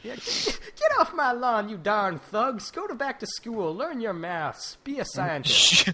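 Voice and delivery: high, slow grumpy yelling; cranky old man. Pretend. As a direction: shout at the kids off your lawn.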